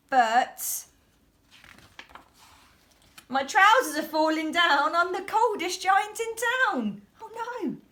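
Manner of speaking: loud, high alarmed wail; freezing giant wailing in dismay; dismayed cry. A narrator's reading. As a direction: break the song in horror. Song ends in dismay.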